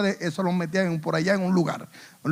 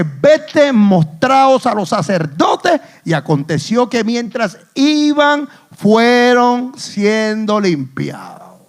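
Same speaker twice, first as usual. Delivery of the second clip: raised, drawn-out scripture declamation; forceful preaching; 3 semitones higher, 5 dB louder, slower than usual. Real, not acted. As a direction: proclaim the verse, stretching the endings. (predicación enérgica, alargando las palabras)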